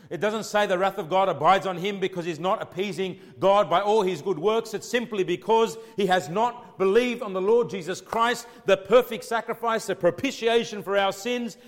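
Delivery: loud, high preaching at full force; fiery preaching. Acted. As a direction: thunder it from the pulpit.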